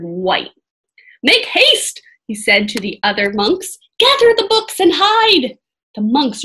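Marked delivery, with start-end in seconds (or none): 1.2-2.0 loud, high order; urgent command. A narrator's reading. Monk orders others to hide. 4.0-5.6 loud, high order; urgent command. A narrator's reading.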